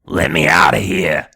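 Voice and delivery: low, slow and loud, a menacing growl from the dark; low, slow, loud creepy voice calling out; creepy dummy voice. Acted. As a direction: boom it out slow like a trapped monster. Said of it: "she hears a voice saying".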